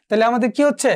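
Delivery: ordinary delivery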